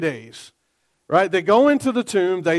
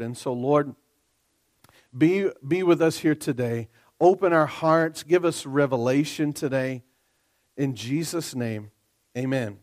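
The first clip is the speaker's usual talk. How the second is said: pastoral prayer; 5 semitones lower than usual. Acted. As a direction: pray humbly over the congregation. Leads congregation in prayer.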